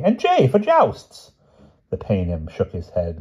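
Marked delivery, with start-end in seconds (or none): 0.0-1.3 high, loud, drawn-out crowing; triumphant cry. A narrator's reading. King finishes explaining his poem, high and triumphant. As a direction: crow it out slowly and proudly. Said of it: "cried the king triumphantly".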